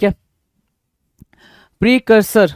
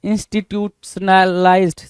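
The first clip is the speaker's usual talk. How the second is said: Institutionalised slowly, broken into institute-sna-lized; slow sounding-out. Shown as a demonstration. Teacher sounds out dictated word. (Institutionalised को टुकड़ों में, धीरे-धीरे बोलते हुए)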